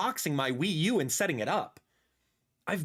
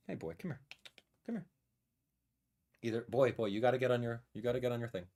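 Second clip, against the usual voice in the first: soft pet talk; 8 semitones lower, 7 dB quieter, faster than usual. Real, not acted. Coaxing the cat, giving treats.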